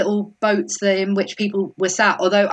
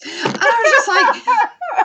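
laughing quote; 4 dB louder, slower than usual. Retold or imitated. Laughing while recounting her reaction.

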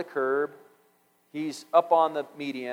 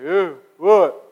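put-on loud, sharp drunk man's voice; drunk man's retort; 3 semitones higher, 10 dB louder than usual. Drunk man turning on a stranger, loud and annoyed. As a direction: snap back, annoyed at the stranger.

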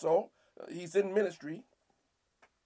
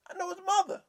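much higher mocking voice; scornful townsfolk; 13 semitones higher, faster than usual. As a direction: belittle him with a sneer.

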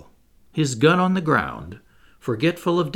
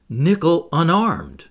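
slow, higher, mildly shocked exclamation; astonished exclamation; 4 semitones higher, 4 dB louder, slower than usual. A narrator's reading. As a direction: draw it out in restrained disbelief. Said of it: "cried Michel Ardan, feeling a terrible pang".

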